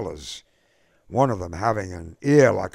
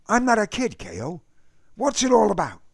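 put-on higher, louder young voice, insisting; indignant young man; 13 semitones higher, 4 dB louder than usual. A narrator's reading. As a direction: push back, you're no kid. Eddie protests to K O.

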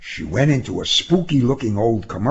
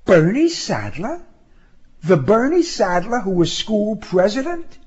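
high, incredulous question; amazed woman's voice, pitched well up; astonished recognition; 11 semitones higher than usual. Acted. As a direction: echo the name in high-pitched surprise, rising at the end.

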